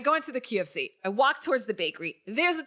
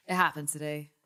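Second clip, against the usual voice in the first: usual speaking voice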